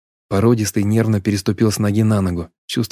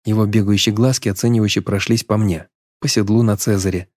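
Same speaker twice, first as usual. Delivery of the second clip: ordinary delivery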